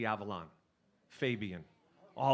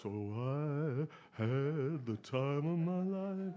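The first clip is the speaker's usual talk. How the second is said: imitating a big deep voice; much slower than usual. Retelling his friend's phone calls, slow deep crooner imitation. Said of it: "he had that big, deep voice".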